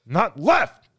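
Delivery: loud, high comic holler; punchy mock yell; mock exclamation. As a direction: shout it in hammed-up exasperation.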